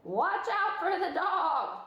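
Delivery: high, slow sheep cry. A narrator's reading. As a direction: bleat a warning slowly. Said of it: "cried the sheep".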